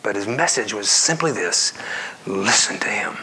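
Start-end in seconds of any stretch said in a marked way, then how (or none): 2.3-3.2 sudden hushed whisper; whispered emphasis on God's words. Real, not acted. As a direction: lean in and whisper it. Preacher drops to whisper for emphasis.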